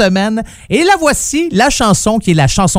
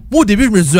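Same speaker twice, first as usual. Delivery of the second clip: ordinary delivery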